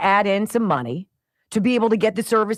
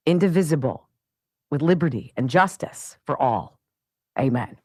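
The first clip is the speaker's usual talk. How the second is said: Pledge of Allegiance recital; 5 semitones lower than usual. Real, not acted. Host opening the show with the pledge, measured recital. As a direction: recite it solemnly, phrase by phrase, low.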